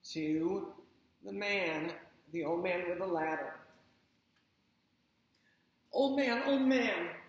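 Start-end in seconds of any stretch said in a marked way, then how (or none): 5.9-7.1 louder, higher call; shouting. Acted. Chang shouting for the old man with the ladder. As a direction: call out across the distance. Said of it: "shouted Chang".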